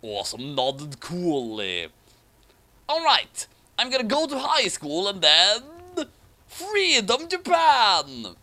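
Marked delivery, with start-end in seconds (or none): 2.9-8.4 rally the troops like a cartoon hero; mock rallying cry. Hero's rallying cry in badfic.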